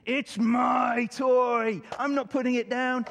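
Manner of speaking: loud, high, slow tantrum shout; exploding child. Retold or imitated. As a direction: shout it like a furious little boy. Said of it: "At which point he exploded".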